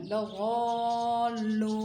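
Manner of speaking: लगौलू held very long; drawn-out half word. Shown as a demonstration. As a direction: stretch लगौलू for the pupil. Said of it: "ऐसे आधा, आधा".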